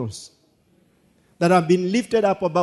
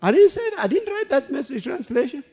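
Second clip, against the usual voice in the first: high squeal of protest; mock protest; 8 semitones higher than usual. Real, not acted. Preacher playfully denying he said dog poop, high squeal. Disowning a crude word, laughing.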